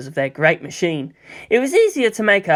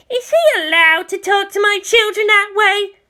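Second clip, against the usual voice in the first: much higher put-on voice, indignant; irate parent. A narrator's reading. Angry parent complaining to a police officer. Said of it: "an irate parent complained".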